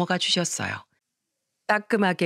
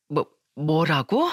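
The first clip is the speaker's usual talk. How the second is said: slow, stunned disbelief; shocked stammer; 3 semitones lower, slower than usual. Acted. Grandmother hearing her grandson's shocking words, stunned.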